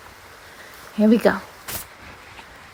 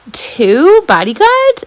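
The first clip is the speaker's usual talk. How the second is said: slow, disbelieving question; can't believe it; astonished, higher; incredulous; 8 semitones higher, 4 dB louder, slower than usual. Acted. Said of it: "Max says incredulously".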